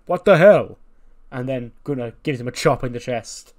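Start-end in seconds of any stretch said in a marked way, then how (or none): 0.1-0.8 indignant yell. Retold or imitated. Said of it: "And he goes".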